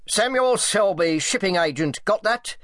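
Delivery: blustering order heard from the corridor; loud voice. A narrator's reading. Selby gives orders in the corridor, loudly brash. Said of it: "she heard a loud voice".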